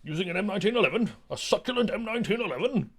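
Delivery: high, swooping mock indignation; theatrical protester. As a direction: parody a dramatic orator's grand questions. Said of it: "He goes".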